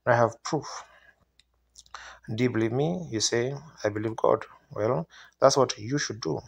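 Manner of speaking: usual speaking voice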